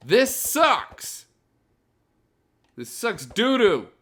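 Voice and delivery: high, loud exasperated shout; loud, high-pitched venting at a broken build; frustrated outburst. Real, not acted. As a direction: yell it out, totally fed up. Fed up with failed build.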